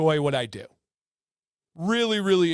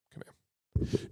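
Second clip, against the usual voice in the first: ordinary talk, nothing marked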